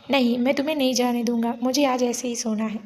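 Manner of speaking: usual speaking voice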